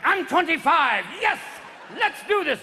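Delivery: high, loud, gung-ho boasting; cocky penis character. Acted. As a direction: charge in like an eager youngster. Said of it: "your penis is like".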